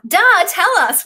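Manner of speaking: ordinary delivery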